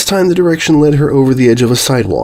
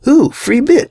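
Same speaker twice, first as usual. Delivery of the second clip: delighted ooh; slower than usual. Acted. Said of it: "she said".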